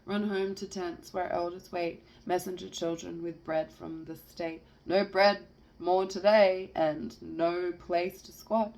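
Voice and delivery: emphatic rhythmic verse recital; poetry reading. Acted. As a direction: recite it with building force.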